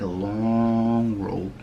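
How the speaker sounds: "long" stretched way out; drawn-out LONG. Retold or imitated. Describing the lady's robe to viewers, "long" drawn out. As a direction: hold the vowel of "long". Stretching the word to show length.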